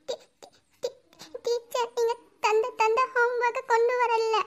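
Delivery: halting sobs on the first word, then a small tearful confession; tearful stammer. Acted. Pupil confesses forgotten homework to the teacher, tearful stammer. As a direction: stammer softly through tears. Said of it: "ஏண்டி அழுவுற?".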